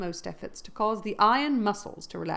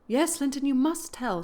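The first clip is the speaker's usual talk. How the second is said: urgent plea; 7 semitones higher, 4 dB louder than usual. Acted. Catherine pleads with Linton.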